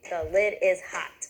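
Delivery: each word of "The lid is hot" hit slowly and separately; pounded word by word. Shown as a demonstration. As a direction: tap out "The lid is hot" one beat per word, slow. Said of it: "you would pound it with me".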